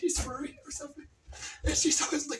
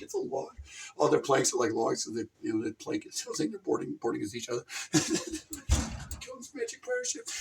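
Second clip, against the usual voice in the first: ordinary talk, nothing marked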